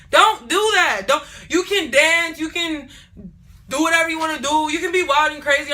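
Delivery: loud and insistent; loud insisting. Real, not acted. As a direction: drive it home loudly. Emphatic lecturing of viewers.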